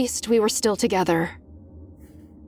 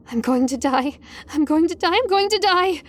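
climbing higher with each repeat; mounting panic; 8 semitones higher than usual. A narrator's reading. Student's own thoughts as panic takes over, mounting terror. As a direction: spiral into frantic, high-pitched panic, no shouting. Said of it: "My brain was screaming".

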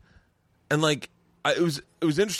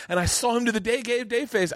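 usual speaking voice

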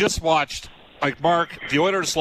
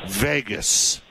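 "Vegas" set apart by pauses, low and deliberate; emphasis on Vegas; 7 semitones lower than usual. Real, not acted. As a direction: land on "Vegas" as a pointed one-word sentence. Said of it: "Emphasis on the word Vegas".

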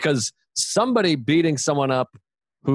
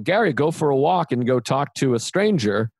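ordinary delivery

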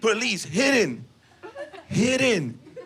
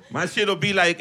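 ordinary talk, nothing marked

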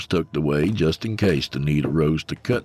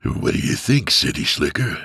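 lightly voiced gruff cowboy villain; Jack Palance. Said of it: "Doing his best Jack Palance impersonation".

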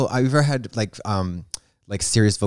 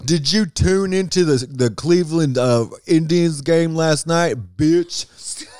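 mock impression; 7 semitones higher, slower than usual. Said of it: "I should do him".

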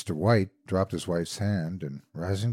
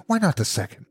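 high-pitched feverish question; 8 semitones higher, faster than usual. A narrator's reading. Old woman pushing for a second wish, feverish. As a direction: press him with fevered hope. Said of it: "said the old woman feverishly".

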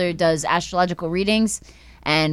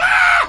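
put-on high, loud scream of chased kids; mock scream; 6 dB louder than usual. Kids running from grandpa's fly swatter, screaming. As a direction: imitate kids shrieking as they flee.

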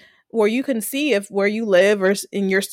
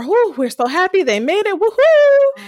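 higher, louder relieved whoop of joy; relieved cheer; 9 semitones higher, 4 dB louder than usual. Retold or imitated. Retelling the audience's relief, cheering woohoo. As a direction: cheer like the heroes just escaped.

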